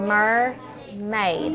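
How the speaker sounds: "Mermaid" as mer, pause, maid; teacher's drawn-out two-beat "Mermaid"; syllable by syllable. Shown as a demonstration. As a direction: leave a clear gap inside "Mermaid". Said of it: "The first syllable or the second syllable?".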